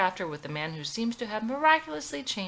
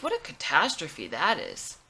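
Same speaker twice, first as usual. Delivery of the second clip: ordinary delivery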